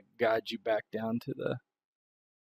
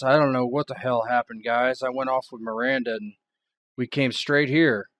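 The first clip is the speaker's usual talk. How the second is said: louder, exasperated sheriff; raised, fed-up lawman voice; 2 semitones higher, 8 dB louder than usual. Acted. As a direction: push it out, frustrated and baffled.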